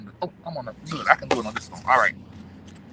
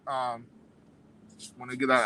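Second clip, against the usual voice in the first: ordinary talk, nothing marked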